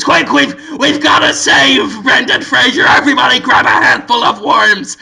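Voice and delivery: rally the troops in mock alarm; hammed-up action-movie command; Willem Dafoe. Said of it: "Willem Dafoe says".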